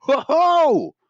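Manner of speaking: loud, high "ho, ho!"; hammed-up whoop of wonder; imitated amazement. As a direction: mimic an astonished moviegoer crying out. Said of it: "he just kept doing it".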